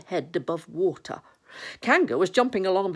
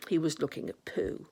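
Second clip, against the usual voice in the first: ordinary delivery, nothing marked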